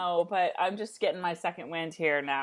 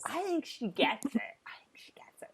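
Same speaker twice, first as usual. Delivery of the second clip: softer whisper on the repeat; 7 semitones higher, 6 dB quieter than usual. Real, not acted. Telling a friend the writer gets the joke, whispered. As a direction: breathe it, then fade it away. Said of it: "repeated hushed line".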